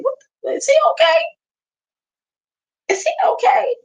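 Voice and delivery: very high squeaky is he okay; incredulous squeal of concern; high-pitched mock worry; mock alarm. Real, not acted.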